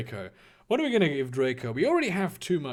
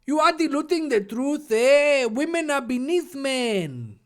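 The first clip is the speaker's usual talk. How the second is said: hammed-up Mexican accent with a raised pitch; caricature Mexican accent; 9 semitones higher than usual. Draco arguing back at Harry, caricature Mexican accent. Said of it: "Draco gasped tentatively".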